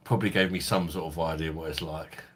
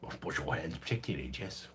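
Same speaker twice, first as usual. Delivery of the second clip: softer, mumbled blustering politician impression; Boris Johnson; 7 dB quieter than usual. Streamer does a requested impression, soft blustering politician voice. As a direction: mumble it like a bumbling prime minister. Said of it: "Boris impression".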